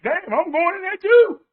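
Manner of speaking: high, loud mock panic; acted alarm. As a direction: cry out in dismayed realization. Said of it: "what are you gonna think?".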